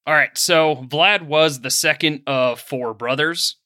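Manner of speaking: ordinary talk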